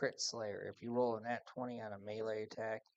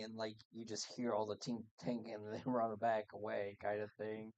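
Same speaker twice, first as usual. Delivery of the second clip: ordinary delivery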